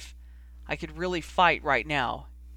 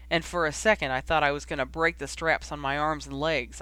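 ordinary delivery, nothing marked

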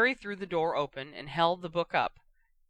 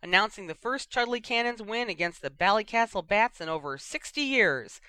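lively, higher Quidditch announcer voice; radio commentator; 5 semitones higher, 5 dB louder than usual. Acted. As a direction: call the match like a cheeky sports commentator. Lee Jordan commentating on the wireless.

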